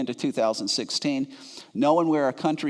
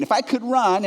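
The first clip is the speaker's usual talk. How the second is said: ordinary talk, nothing marked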